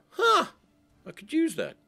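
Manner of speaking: high, loud "huh" of discovery; pleasant surprise. Acted. Acting out finding an asset.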